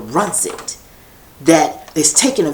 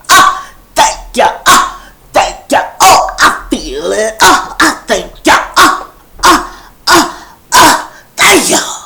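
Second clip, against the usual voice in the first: church praise; 8 semitones higher, 6 dB louder than usual. Retold or imitated. Imitating spiritual praise.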